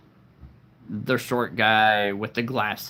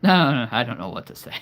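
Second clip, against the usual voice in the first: normal talking voice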